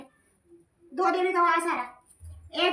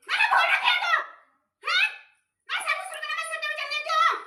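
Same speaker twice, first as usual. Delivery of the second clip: fierce goddess shout; 10 semitones higher than usual. Acted. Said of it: "मेरा स्वभाव है ये बोलने का".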